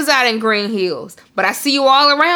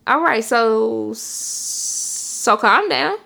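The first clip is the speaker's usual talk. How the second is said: soothing mom; much slower than usual. Retold or imitated. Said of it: "She just be like".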